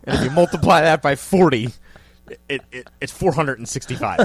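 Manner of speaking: loud, mock-confused counting through a sum; mock idiot doing math out loud; playing dumb at math. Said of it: "pretends to be a total idiot at math".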